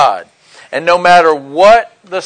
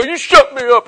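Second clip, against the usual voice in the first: higher, put-on voice daring them; mock belligerent retort; 6 semitones higher than usual. Drunk Marine talks back to cellmates, mock belligerence. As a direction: act a drunk picking a fight.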